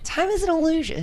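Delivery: high, loud mock-philosophical declaration; mock profound. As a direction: deliver it like a hammy oracle.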